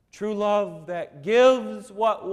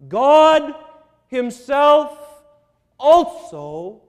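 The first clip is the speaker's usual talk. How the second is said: much louder and higher, one word at a time; emphatic proclamation; 9 semitones higher, 14 dB louder, much slower than usual. Acted. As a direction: hammer each word. Emphatic preaching climax.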